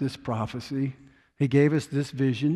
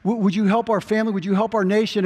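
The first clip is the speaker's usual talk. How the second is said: raised, pleading prayer voice; 7 semitones higher than usual. Retold or imitated. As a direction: beg God for help, voice up high. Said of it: "We say".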